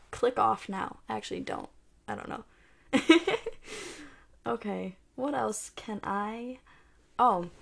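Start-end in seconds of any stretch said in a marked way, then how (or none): 2.9-4.1 breathy laugh. Real, not acted. Laughs at herself.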